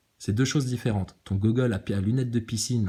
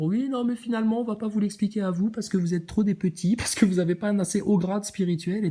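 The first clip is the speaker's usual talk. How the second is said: higher, mock-snooty dismissal; mock haughty guru; 7 semitones higher than usual. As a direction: imitate condescending gurus, higher voice.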